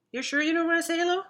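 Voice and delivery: high, fast pet voice. Real, not acted. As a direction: coax the pet sweetly. Coaxing pet to greet.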